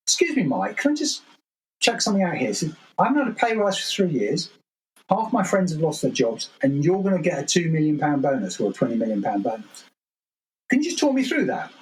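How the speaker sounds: high, pointed mock voice of a blunt engineer; beardy engineer. Engineer challenging the boss's bonus at a town hall, high mock politeness. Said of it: "some beardy engineer would put his hand up and go".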